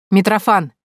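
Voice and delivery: calling out. A narrator's reading. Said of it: "крикнул он".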